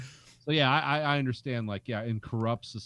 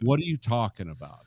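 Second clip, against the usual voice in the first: usual speaking voice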